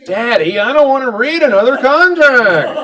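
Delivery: put-on high whining little boy voice; whiny kid. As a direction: whine it like a bored five-year-old. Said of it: "son's whining reply voiced".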